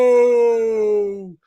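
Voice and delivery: loud, drawn-out mock refusal; emphatic no. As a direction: shout a firm NO as if on request. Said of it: "that's how you want that said".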